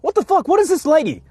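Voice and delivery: high-pitched sneering parody of angry tourists; mocking angry tourists. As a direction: ham up the rude tourists' yelling. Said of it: "they went like".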